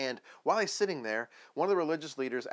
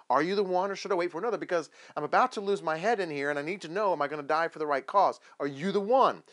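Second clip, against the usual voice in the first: louder, raised, pressing questions; urgent pleading; 3 semitones higher, 7 dB louder than usual. Acted. John in prison asking Jesus through his disciples, urgent and desperate. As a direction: demand an answer with your life at stake.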